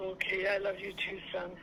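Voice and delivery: ordinary talk, nothing marked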